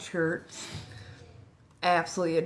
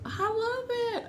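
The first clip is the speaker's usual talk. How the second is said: much higher, slow delighted I love it; high delighted squeal; slower than usual. Real, not acted. Excited fan reaction, repeated.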